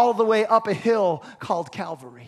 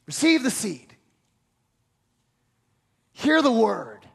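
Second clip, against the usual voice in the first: ordinary delivery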